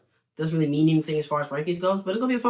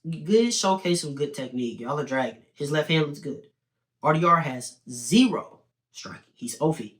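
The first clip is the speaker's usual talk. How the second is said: normal talking voice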